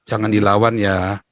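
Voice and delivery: slow, low imitation of a father; father's calm advice. Father telling his son not to fight back, calm advice. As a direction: talk the child down slowly and evenly. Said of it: "ayah saya selalu bilang apa?".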